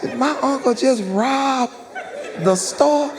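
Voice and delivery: put-on a child realizing the crime; high-pitched disbelief; shocked kid thought. Kid's shocked inner thought.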